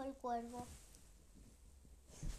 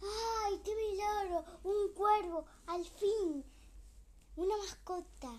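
excited cry, higher and louder; overjoyed at finding a pet at last; delighted; 6 semitones higher, 5 dB louder than usual. Acted. Witch finds a crow, overjoyed and loud.

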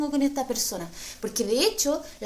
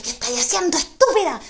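sharp louder reproach from the mirror self; scolding inner voice; 5 semitones higher, 5 dB louder than usual. Retold or imitated. Mirror voice scolding her, louder sharp reproach. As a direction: snap at yourself like a stern reflection. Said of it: "me habló la del reflejo".

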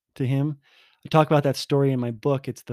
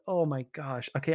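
ordinary delivery

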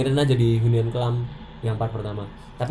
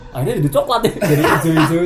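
laughing; 3 semitones higher, 6 dB louder than usual. Real, not acted. Laughing while describing the dung.

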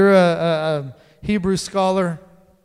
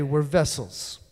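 ordinary talk

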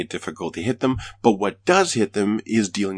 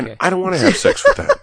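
laughing; 12 semitones higher, 6 dB louder, faster than usual. Real, not acted. Laughing rejoinder.

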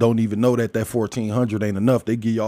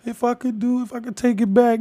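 mock crying woman; 12 semitones higher than usual. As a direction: imitate a woman crying on a live stream, hammed-up. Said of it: "crying and shit".

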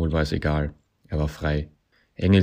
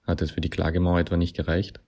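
ordinary delivery

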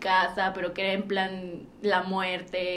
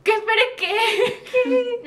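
baffled laughing disbelief; 12 semitones higher, 5 dB louder, much slower than usual. Retold or imitated. Retold confusion, laughing.

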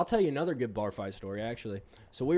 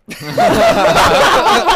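loud high burst of laughter; howling laugh at the story's punchline; big high laugh; 22 dB louder than usual. Real, not acted. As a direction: let out a big laugh at the twist.

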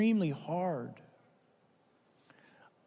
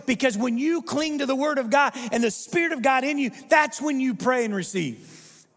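raised, urgent preaching as God's voice; fervent preaching; 6 semitones higher than usual. Acted. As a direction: press God's call on the crowd.

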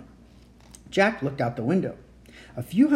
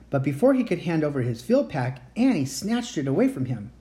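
ordinary delivery

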